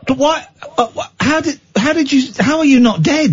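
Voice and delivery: high-pitched, stammering disbelief; incredulous. Real, not acted. Host amazed caller survived the fall, incredulous. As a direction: question it in high, stumbling amazement.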